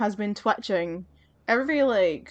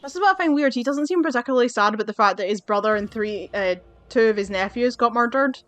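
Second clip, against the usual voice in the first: ordinary delivery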